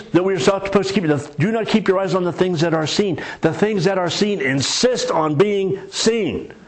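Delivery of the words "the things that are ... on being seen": slow, weighty preacher's emphasis, higher; slow emphatic. Acted. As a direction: stretch every word slowly to drive it home. Preacher hammers his key point.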